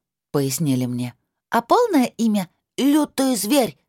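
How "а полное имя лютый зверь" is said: put-on high child voice, slow proud announcement; a small girl drawing out a grand name; little girl voice.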